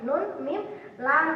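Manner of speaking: ordinary talk, nothing marked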